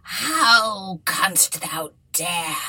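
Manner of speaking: slow angry witch voice; angry enchantress. A narrator's reading. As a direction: lean on each word like a furious witch. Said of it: "she said with an angry look".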